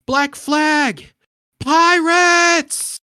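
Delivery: high, drawn-out alarm call; acted cry from a ship's lookout; lookout's shout. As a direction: shout a warning down from the crow's nest. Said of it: "someone in the crow's nest shout".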